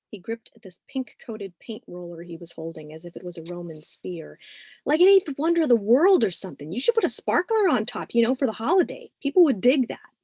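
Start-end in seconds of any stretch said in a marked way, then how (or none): 4.9-10.1 put-on excited teen boy. Narrator voices eager teen Carlos.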